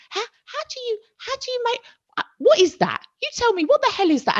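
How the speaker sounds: very high, squeaky disbelief; baffled, voice shooting right up; incredulous. Real, not acted. Telling friends about her impossible turnaround, incredulous and high.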